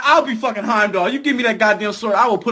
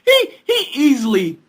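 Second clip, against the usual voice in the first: usual speaking voice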